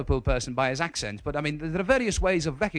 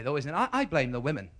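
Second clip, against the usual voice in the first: usual speaking voice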